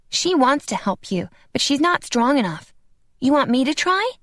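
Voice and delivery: lightly voiced earnest little boy; a small boy, eager to help. A narrator's reading. Tino, child voice.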